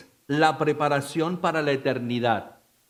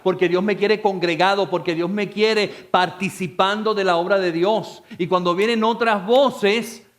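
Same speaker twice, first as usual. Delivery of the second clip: louder, higher, driving repetition; full-force preaching; 7 semitones higher, 7 dB louder than usual. Acted. Pastor rallying the congregation against false voices, full-force preaching. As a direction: preach it out, stacking each "porque".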